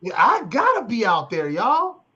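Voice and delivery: loud mock-desperate appeal, swooping pitch; pleading fundraiser. Nonprofit leader mocks funding pitches to funders, hammed-up pleading. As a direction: cry out like an overwrought fundraiser. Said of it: "you gotta tell them how awful the people are".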